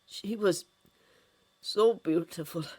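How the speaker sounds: halting, regretful condolence; sincere, wistful pauses. A narrator's reading. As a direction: say it slowly, lost in memory. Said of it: "Regret crossed his face, now far more sincere".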